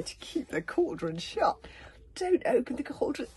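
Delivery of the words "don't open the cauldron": frightened plea. Acted.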